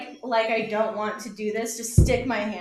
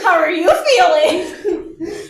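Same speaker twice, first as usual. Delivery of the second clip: laughing; 4 semitones higher, 8 dB louder, much slower than usual. Real, not acted. Slow laughing repeat.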